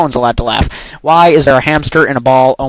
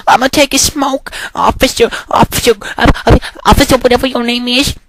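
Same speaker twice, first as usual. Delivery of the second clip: mock officer chat; 8 semitones higher than usual.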